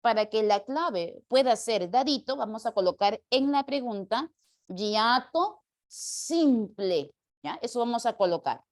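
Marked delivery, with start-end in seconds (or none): 4.7-7.1 "hiato simple" drawn out word by word; slow dictation. Shown as a demonstration. Teacher adding words to the question, slowly dictating "hiato simple". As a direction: stretch each part of "hiato simple".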